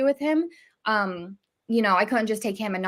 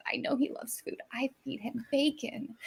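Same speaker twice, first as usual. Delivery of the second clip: whispered aside; 10 dB quieter than usual. Retold or imitated.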